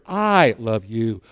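"I" drawn out long, rest falls away; stress on I. Shown as a demonstration. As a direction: lean slowly on the word "I". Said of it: "I use different emphasis on different words".

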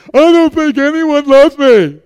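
loud, high, drawn-out mock wail; hammed-up little girl's complaint; wailing child imitation. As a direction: wail it slowly like a dramatic child. Mimics daughter's complaint to dad.

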